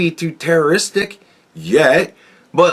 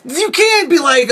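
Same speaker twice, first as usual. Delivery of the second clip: outraged rant; 9 semitones higher than usual. Real, not acted. Ranting, outraged at refugee claims.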